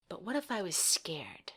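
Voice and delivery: a small frightened whisper; scared whisper. Acted. A scared kid whispering her fear to herself. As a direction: whisper the worry as if afraid of the dark.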